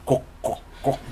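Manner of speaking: imitated heel clicks, spaced out; clicking heel noise. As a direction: knock out heel sounds with the mouth. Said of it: "making noise".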